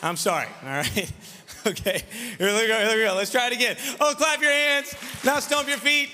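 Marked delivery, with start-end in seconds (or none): 4.0-6.1 rousing call-and-response. Real, not acted. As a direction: whip the crowd into shouting back. Leads shouted call-and-response.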